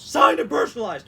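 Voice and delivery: sudden loud burst mid-sentence; all-caps shout. Acted. Host mocks a photo offer, shouting key words. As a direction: blare it like a sales pitch. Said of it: "certain things that, like, is being all caps".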